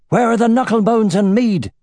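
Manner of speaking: loud, high impatient shout. A narrator's reading. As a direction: yell it over the hooves. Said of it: "he shouted".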